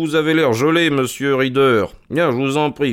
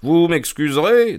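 honeyed; 9 semitones higher, 3 dB louder than usual. Acted. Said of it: "dit Holmes mielleusement".

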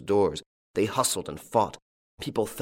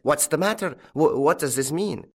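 higher-pitched, startled stammer; alarmed cry; 6 semitones higher than usual. A narrator's reading. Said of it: "He cried".

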